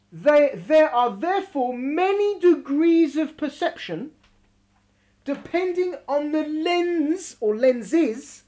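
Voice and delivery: slow, measured teaching read; emphatic reading aloud. Acted. Teacher reading a text on prophecy to students, deliberate emphatic reading.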